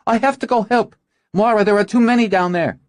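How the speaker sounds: high, loud and hurried; urgent. Acted. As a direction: press to rush into the fight. Urgent during battle.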